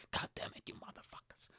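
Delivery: hissed whisper swearing; joking whispered swearing at the restaurant chain; whispered curse. Real, not acted. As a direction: curse under your breath. Mock anger at Pizza Express.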